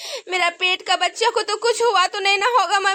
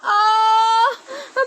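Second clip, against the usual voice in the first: pained cry. Acted. Pregnant daughter-in-law in pain.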